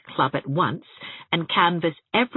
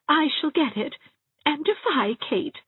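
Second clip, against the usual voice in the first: put-on higher, timid old lady voice; anything but defiant; 11 semitones higher than usual. A narrator's reading. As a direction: try to sound bold and fail. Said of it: "anything but defiantly".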